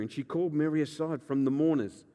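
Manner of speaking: usual speaking voice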